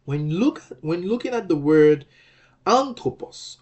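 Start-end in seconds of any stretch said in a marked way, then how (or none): 2.6-3.6 anthropos with a high, strong AN; stress on first syllable. Shown as a demonstration. Teacher shows where anthropos is normally accented, stress on AN. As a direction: hit the AN of anthropos, then drop. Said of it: "the accented syllable is an".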